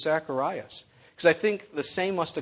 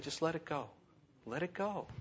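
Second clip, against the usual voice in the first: gentle God voice; 3 semitones higher, 11 dB quieter than usual. Acted. God voice, soft.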